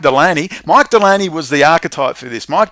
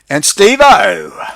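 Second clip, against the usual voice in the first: playful shout-out; 6 semitones higher, 8 dB louder, much slower than usual. Real, not acted. Playfully calls out a donor.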